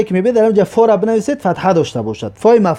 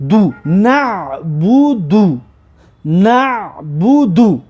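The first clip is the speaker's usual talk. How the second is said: very slow, stretched "نه بو دو"; syllable sounding-out; much slower than usual. Shown as a demonstration. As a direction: draw out every syllable as a model for learners.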